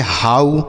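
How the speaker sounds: "हाउ" with ow sounded as "आऊ"; ow read as au. Shown as a demonstration. Teacher reads out the spelled word, "हाउ" with au. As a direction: model "हाउ" with an au glide. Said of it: "तो इसे पढ़ेंगे".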